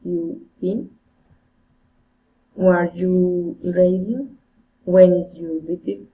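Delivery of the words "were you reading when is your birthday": questions ending on a clear rise; rising intonation. Shown as a demonstration. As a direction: lift the tune at the end of each question. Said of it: "raising intonation".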